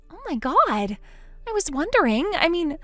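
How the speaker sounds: piecing together what her family did; worried younger sister. Acted. Molly, uneasy realization.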